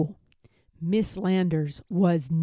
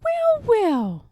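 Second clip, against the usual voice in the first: high, sing-song well, well; a kindly teacher musing; art teacher voice; 4 dB louder than usual. A narrator's reading.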